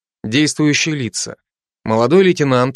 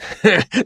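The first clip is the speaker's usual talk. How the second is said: mocking laugh; 7 semitones higher than usual. Georgian laughs at Ukrainian.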